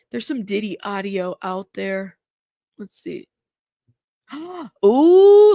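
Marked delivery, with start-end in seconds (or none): 4.3-5.5 surprised gasp. Real, not acted. Surprise at new report.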